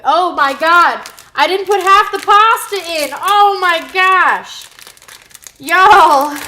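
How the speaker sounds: oh no, loud comic panic; shocked panic. Real, not acted. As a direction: realize the mistake and yell out. Forgot half the pasta.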